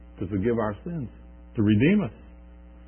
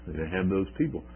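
ordinary talk, nothing marked

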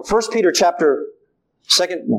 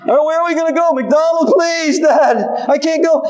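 put-on high, whiny child in the back seat; whiny kid voice; 8 semitones higher than usual. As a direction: whine like a hungry kid on a long drive. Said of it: "kids in the back of the car".

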